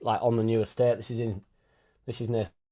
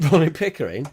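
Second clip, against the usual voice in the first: laughing; 5 semitones higher, 4 dB louder than usual. Real, not acted. Laughing at a chat reference.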